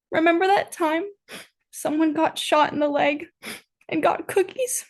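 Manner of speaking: high-pitched, tearful mock whimper; mock sobbing. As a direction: whimper it high, in broken pieces, as a hammed-up sob. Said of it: "Hotch sobs".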